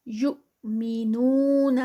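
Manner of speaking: یؤمنون with a sharp jerk on the hamza; slow recitation of یؤمنون, jerk on the ؤ; recited with jerk. Shown as a demonstration. Teacher models the hamza for pupils, slow recitation. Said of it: "یہ جو حمزہ ہے یہ جب جزم".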